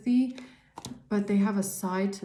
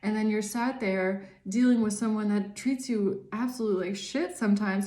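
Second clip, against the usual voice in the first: ordinary delivery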